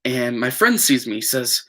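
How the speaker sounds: ordinary talk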